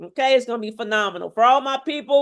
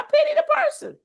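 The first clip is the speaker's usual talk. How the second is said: emphatic preaching. Real, not acted. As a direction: voice leaps up very high on pity. Escalating sermon-like rant on junk food.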